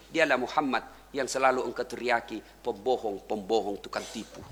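very low, soft, grieving voice; tearful reply, retold. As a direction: reveal it quietly, fading almost away. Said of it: "menetes air matanya lalu berkata".

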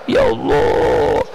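high, very slow wailing cry; crying out to God. Retold or imitated. Poor father crying to God, slow high wail. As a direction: let the voice crack upward into a wail. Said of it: "nangis dia".

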